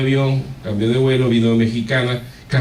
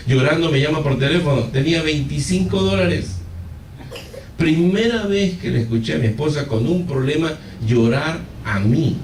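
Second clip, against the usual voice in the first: normal talking voice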